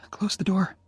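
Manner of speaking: put-on firm little command from a sick girl; a commanding young woman, lightly voiced; ordering as Jenny. A narrator's reading. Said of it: "Jenny ordered".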